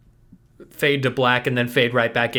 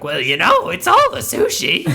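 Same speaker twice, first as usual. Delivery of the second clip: high, squeaky clown voice; 3 semitones higher, 3 dB louder than usual. Pretend. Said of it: "Harold ... he's like".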